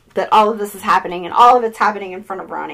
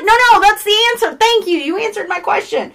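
high, excited brush-off; acted triumphant thanks; retold eager insistence; 9 semitones higher than usual. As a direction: cut off the protest gratefully. Rose insists she has her answer.

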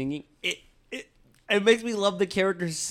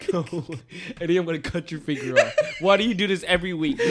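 ordinary delivery

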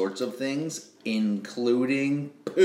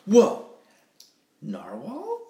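surprised jellyfish; 9 semitones higher, 3 dB louder, slower than usual. Acted. Reading Jelly's lines.

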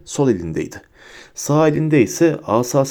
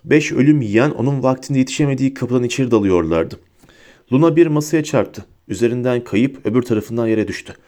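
plain reading voice

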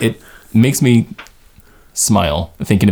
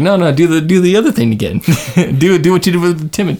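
higher-pitched acted protest with a laugh; mock pleading; 8 semitones higher than usual. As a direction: play an insistent fan begging the filmmaker. Acts out own reaction to film.

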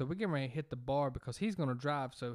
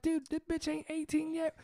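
high mock outraged voice; mock outrage. Telling his friend the girl is underage, high mock squeal. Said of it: "I was like".

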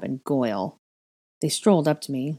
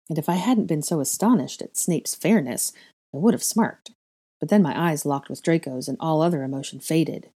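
ordinary delivery, nothing marked

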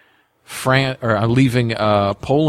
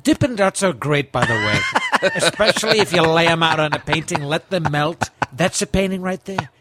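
Trump impression, brash and upbeat; boastful Trump riff; 4 semitones higher than usual.

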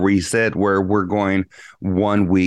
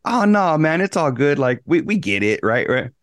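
reassuring buddy; 5 semitones higher than usual. Retold or imitated. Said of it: "expecting my buddy to be like".

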